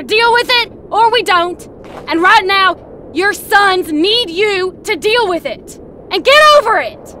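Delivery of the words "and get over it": higher, louder demands; angry demand. Acted. Man ordering the widower to pull himself together, angry yelling. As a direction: drive it home, hammering each order.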